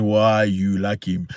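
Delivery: low, grumbling put-on voice of a rival suitor; lightly voiced jealous man; mock jealous local man. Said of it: "then the South African man goes".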